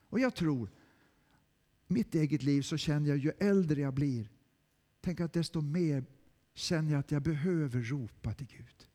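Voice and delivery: ordinary delivery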